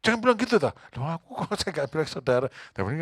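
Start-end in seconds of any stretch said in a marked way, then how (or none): 0.0-0.7 wife scolding. Retold or imitated. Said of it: "Ngomel sama saya".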